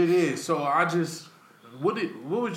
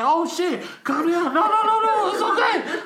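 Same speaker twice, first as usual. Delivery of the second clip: laughing mock panic; 6 dB louder than usual. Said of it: "I was like".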